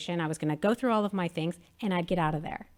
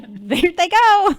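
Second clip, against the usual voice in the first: high, loud, slow delighted cheer; family cheering at the screen; delighted squeal; 10 semitones higher, 10 dB louder, much slower than usual. Retold or imitated. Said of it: "We were like".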